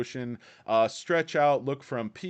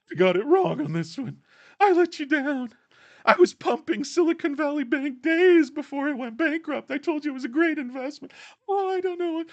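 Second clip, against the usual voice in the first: much higher, louder, whiny mock-tearful pundit; weepy Cramer; 7 dB louder than usual. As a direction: squeak out a hammed-up tearful confession. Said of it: "he's always crying".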